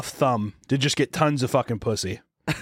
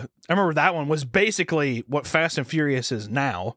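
ordinary talk

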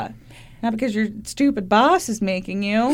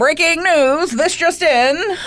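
raised-pitch breaking news announcer; mock newsflash; 6 semitones higher, 3 dB louder, slower than usual. Co-host introducing merch news, mock newscaster. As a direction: announce it like an urgent bulletin, drawing out the end.

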